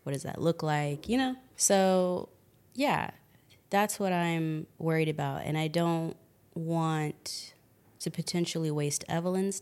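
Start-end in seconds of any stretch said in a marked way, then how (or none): none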